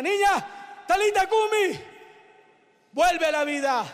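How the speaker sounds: high, ringing call; acted command. Jesus commands the dead girl to rise, acted call.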